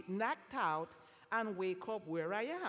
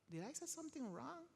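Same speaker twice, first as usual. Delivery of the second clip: worried inner thought; 5 semitones higher, 11 dB quieter, faster than usual. Retold or imitated. Inner worried thought after man cries.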